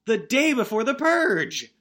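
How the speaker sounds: slow, high, loud indignation; rising theatrical outrage; mock outrage. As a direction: hit each word in comic disbelief. Outraged at the insurance hike.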